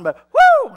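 very high loud exultant cry; joyful whoop. Real, not acted. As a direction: let the steam out with a whoop. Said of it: "I gotta let some steam out".

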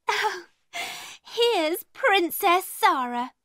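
high, slow sneering tease; mocking. A narrator's reading. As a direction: drawl out the mock title to taunt her. Lavinia mocks Zara.

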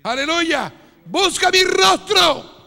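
loud pleading preacher call, slowing on the appeals; preaching at full force. Real, not acted. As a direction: cry out God's invitation, drawing it out. Llamado del Señor gritado. (llamado de predicador a gritos, alargando las súplicas)

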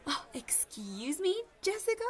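high, loud indignant squeak of a name; shocked rising "Jessica?"; outraged question. Acted. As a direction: act scandalized at your friend. Mock outrage at friend.

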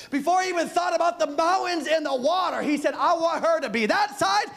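preaching at full force. Acted. Preaching build.

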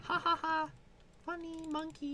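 high cartoonish voice; silly high voice. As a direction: squeak it out like a cartoon. Silly put-on voice.

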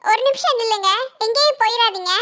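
put-on small girl asking the old woman to wait, high and soft; little girl voice. Little girl calls grandma.

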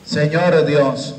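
normal talking voice

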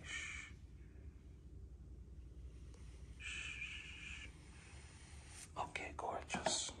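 very soft conspiratorial whisper; hushed, confidential aside; secretive whisper. Real, not acted. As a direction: whisper it like a secret to fellow painters. Shares a secret with painters.

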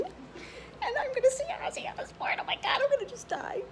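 high, squeaky whispered squeal; excited whisper. Real, not acted. Going to see [name], excited whisper. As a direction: whisper it like you might burst. Giddy about seeing her idol.

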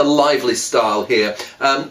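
normal talking voice

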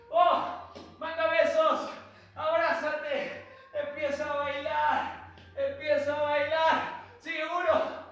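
loud, high energetic class calling over music; fitness class calling. Shown as a demonstration. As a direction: pump the class up, calling out counts. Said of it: "clase de baile con música". (instructor shouting counts and cues over the music)

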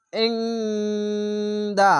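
ইংদা held very long with a squeezed throat ain; slow recitation. Shown as a demonstration. Teacher demonstrating throat sound, slow recitation. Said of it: "আইনকে মোটা করে গলা চিপে পড়বেন".

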